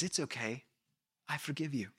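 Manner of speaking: soft, hushed reassurance; softly, like forgiving a friend; quiet gentle forgiving voice; gentle forgiveness. Retold or imitated. Voicing gentle covenant group.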